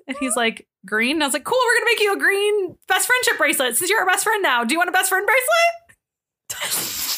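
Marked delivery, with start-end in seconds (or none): 1.4-7.1 high, gushing, stretched at the end; over-eager offer. Retold or imitated. Woman offering the gym man a friendship bracelet, gushing and high. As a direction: pitch it up like an overkeen new best friend.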